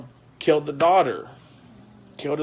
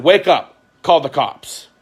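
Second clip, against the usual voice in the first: louder, higher urgent order; urgent call; 4 semitones higher, 6 dB louder, slower than usual. Retold or imitated. Friend telling the wife to call police, urgent. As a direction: sound the alarm.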